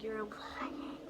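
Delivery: hushed, unvoiced "you're lying"; whispered disbelief. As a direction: whisper it like you can't accept it. Secret disbelief toward friend in car.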